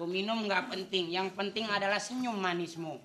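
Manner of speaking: soft, low doting father; tender parent. Acted. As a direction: coo lovingly at your child.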